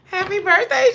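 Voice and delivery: high, loud birthday wish breaking with laughter; laughing-crying wish. Real, not acted. Wishing Jesus happy birthday, high laughing-crying squeal. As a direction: squeal it through tears of laughter.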